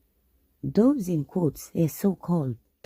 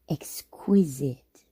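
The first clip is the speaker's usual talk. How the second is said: ordinary talk, nothing marked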